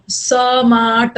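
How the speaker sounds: Smart stretched out very slowly, sound by sound; broken into sounds. Shown as a demonstration. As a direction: drag smart apart as s-maa-rt. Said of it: "ऐसा नहीं बोल रहे हो ना".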